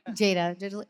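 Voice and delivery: ordinary talk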